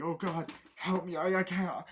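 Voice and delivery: high, loud desperate plea; panicked cry. Retold or imitated. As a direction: beg for help in a panic. Man lost and panicking.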